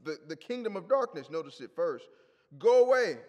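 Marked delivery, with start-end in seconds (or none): 2.6-3.2 loud, high burst of alarm; acted cry, high and very loud; crying out loudly. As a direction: shout it like the demon crying out. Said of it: "He cried out at the top of his voice".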